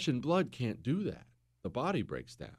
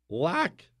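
voice crack. Real, not acted. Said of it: "voice crack there".

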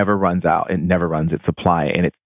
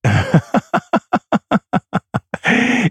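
high airy extended laughter; long high laugh; 13 semitones higher than usual. Acted. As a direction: let the giggle roll on.

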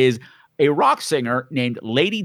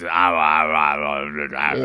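mock affected drawl, much lower; 12 semitones lower, much slower than usual. Host imitating the character's affected wig voice, low and hammy. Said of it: "not so much an accent than an affectation".

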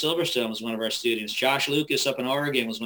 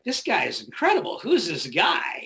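amazed; 5 semitones higher than usual. Retold or imitated. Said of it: "I'm like".

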